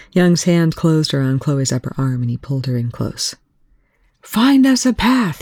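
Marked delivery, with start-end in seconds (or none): 4.2-5.4 high, loud and slow over gunfire; shouting. Acted. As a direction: yell it over the roar of weapons. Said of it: "he shouted over the roar".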